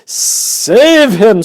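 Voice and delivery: high, loud desperate scream; a mother screaming as her son falls in. Acted. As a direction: scream for help. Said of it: "screamed Missus Gloop".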